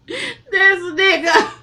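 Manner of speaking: high, loud, stretched-out laughing cry; laughing disbelief. Real, not acted. Laughing at how gangster Mickey is, high and loud. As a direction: crack up in disbelief. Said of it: "Mickey is gangster as fuck".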